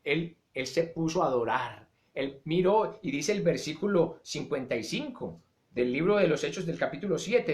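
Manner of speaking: ordinary talk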